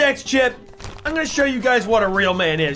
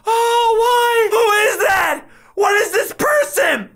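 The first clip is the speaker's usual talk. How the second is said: high, drawn-out anguished wail; wailing protest; 11 semitones higher, 3 dB louder, slower than usual. Real, not acted. Player loses the round and faces another chip, wailing. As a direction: cry out in exasperated despair.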